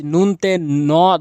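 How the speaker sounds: teacher stretches out নুনতে ন loudly so students hear it; slow emphatic spelling. Shown as a demonstration. Teacher drilling a letter for students, slow and loud. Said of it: "দন্তা ন এনেছি".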